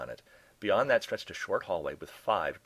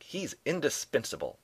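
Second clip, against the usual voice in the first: higher-pitched, insistent retort; shot back; 7 semitones higher than usual. A narrator's reading. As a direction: insist on it as a comeback. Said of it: "Doe shot back".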